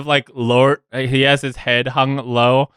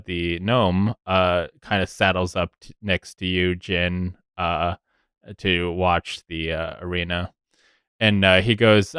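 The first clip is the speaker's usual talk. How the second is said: ordinary talk, nothing marked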